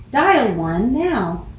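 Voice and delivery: slow, even recorded operator voice; auto attendant voice. Shown as a demonstration. Performing her phone voice on command, slow and measured. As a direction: read it like a phone menu recording. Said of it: "but I'll try it".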